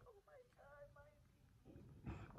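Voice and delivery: tiny, very high hushed squeal; retold overwhelmed awe. Mother looking down at newborn, overwhelmed. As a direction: squeak softly, acting overcome. Acting out first sight of baby.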